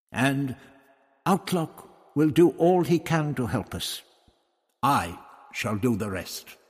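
a hint of a self-assured old doctor. Doctor voiced, higher.

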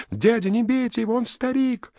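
put-on high, fast tearful boy shouting; desperate child's cry. A narrator's reading. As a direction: plead in tears as a boy. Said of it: "со слезами отчаяния закричал Гаврик".

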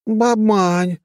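high, loud excited cry; excited exclamation. Acted. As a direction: blurt it out in delight. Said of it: "воскликнула блондинка".

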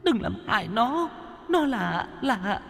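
high, soft desperate pleading. A narrator's reading. Mother begging the attacker to spare her daughter, high tearful plea. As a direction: beg for the child's life, voice high and thin. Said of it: "Người phụ nữ cầu xin".

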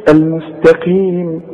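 "المستقيم" slow with thin t, long ee; careful light ta. Shown as a demonstration. Teacher shows the student, slow "المستقيم" with light ta. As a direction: draw out "المستقيم", keeping ta light. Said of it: "شوف".

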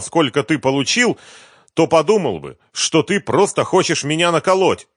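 high, loud angry rant. Acted. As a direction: tear into him. Boss keeps berating partner.

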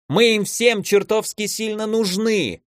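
high, slow, emphatic cry without real volume; mild acted bark; barked exclamation. A narrator's reading. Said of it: "гаркнул архимаг".

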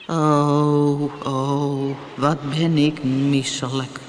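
slow, drawn-out groan of a queasy whale; long monotone moans, feeling wretched; groaning sick whale. A narrator's reading. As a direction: moan it out slowly, sick to the stomach. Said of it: "toen de drie vrienden de walvis hoorden kreunen".

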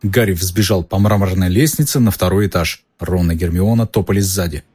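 ordinary delivery, nothing marked

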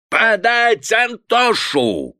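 high, slow, bellowing command; raging father yelling the order; furious shout. A narrator's reading. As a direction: roar for the son.

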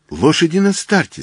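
high-pitched cry of alert; excited cry. A narrator's reading. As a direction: call out that the race is on. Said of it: "закричал я".

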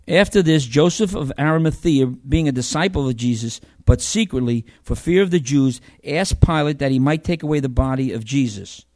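loud, measured Bible reading; scripture reading. Acted. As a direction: read it out to the congregation. Reads John 19 aloud.

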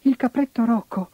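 put-on high squeaky kid goat; tiny shrill bleating voice; squeaky little goat voice. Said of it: "con la sua vocina stridula".

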